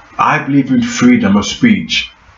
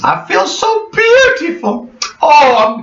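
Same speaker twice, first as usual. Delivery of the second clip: very high, gushing self-admiration; preening diva; 12 semitones higher than usual. Pretend. Showing off new figure to viewers, high and preening. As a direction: coo like a vain starlet.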